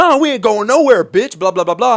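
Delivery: high, loud taunt; defiant mock shout. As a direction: bark it back at him. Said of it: "my cousin was like".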